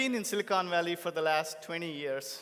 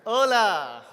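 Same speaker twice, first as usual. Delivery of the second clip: big shouted hello to the hall; louder crowd greeting; 6 semitones higher, 14 dB louder than usual. Real, not acted. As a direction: call it out with more energy. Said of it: "More energy, please".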